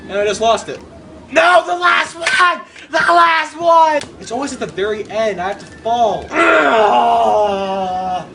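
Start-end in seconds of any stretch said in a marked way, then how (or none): 1.3-4.2 high, slow, stretched-out yell; anguished shout. Real, not acted. Player losing the last one, shouting in anguish. 6.3-8.3 drawn-out growly "ugh"; long frustrated groan. Real, not acted. Player falling at the very end, groaning in frustration.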